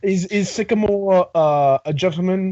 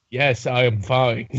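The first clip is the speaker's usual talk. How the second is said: slow, lowered pet voice; slow low owl voice; 7 semitones lower, slower than usual. Pretend. Voicing the owl Dali.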